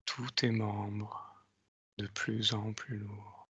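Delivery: slow, soft hypnotic countdown; slow hypnotist voice. Acted. Leads a hypnotic induction. (voix lente d'hypnotiseur)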